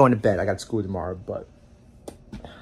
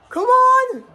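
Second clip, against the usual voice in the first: exasperated yell; 5 dB louder than usual. Real, not acted. Frustrated reaction to play.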